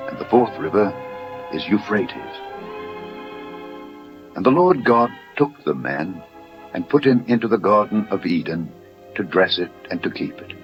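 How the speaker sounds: ordinary delivery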